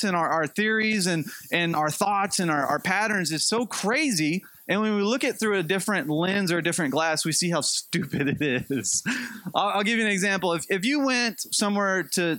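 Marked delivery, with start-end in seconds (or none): none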